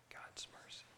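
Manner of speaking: hushed, voiceless whisper of praise; barely audible whispered declaration. Shown as a demonstration. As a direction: whisper it very softly. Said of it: "we're just gonna whisper it".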